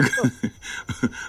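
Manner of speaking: breathless, unvoiced burst of laughter; real laugh. Real, not acted. Host cracks up at the caller's laugh, real laughter. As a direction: caught off guard, laughs helplessly.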